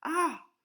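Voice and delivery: high loud scream. A narrator's reading. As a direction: shriek in fright.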